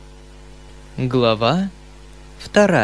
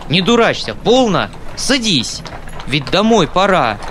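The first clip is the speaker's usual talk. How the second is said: losing patience; 5 semitones higher than usual. Acted. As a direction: call him back, fed up. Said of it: "выходя наконец из терпения".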